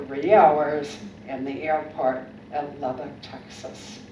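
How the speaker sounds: low, heavy complaining voice; gloomy complaint. Retold or imitated. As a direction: moan about the airport. Mocking the friend's complaint.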